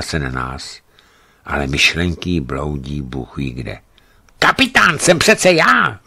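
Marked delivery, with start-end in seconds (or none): 4.4-6.0 much higher, loud feverish outcry; delirious cry. A narrator's reading. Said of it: "vykřikla".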